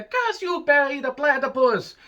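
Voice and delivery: high, strained cartoon villain cry; Doofenshmirtz impression. Vlogger opening with a villain's cry. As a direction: yell it like a cartoon mad scientist. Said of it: "Terrible Doofenshmirtz impersonator".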